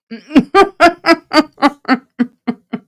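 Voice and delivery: big bright burst of laughter; loud high laugh. Real, not acted. Laughing at a chat comment.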